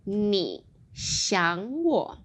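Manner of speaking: very slow, careful 你想我; drawn-out model of 你想我; slow teaching model; slow demo. Shown as a demonstration. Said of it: "I've also got".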